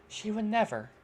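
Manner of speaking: normal talking voice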